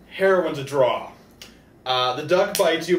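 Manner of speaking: usual speaking voice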